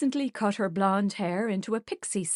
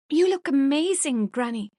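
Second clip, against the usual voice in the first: bright, higher, louder exclamation to granny; exclaiming; 6 semitones higher, 5 dB louder than usual. Acted. Granddaughter exclaiming over her granny's dress, a touch excited. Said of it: "Vi exclaimed".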